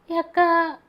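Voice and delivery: high, loud sob on the word; mother sobbing to an older woman; sobbing plea. Acted. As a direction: call out to her through tears. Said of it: "என்ற விம்மலோடு".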